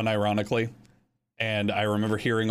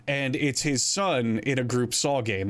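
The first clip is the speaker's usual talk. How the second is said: normal talking voice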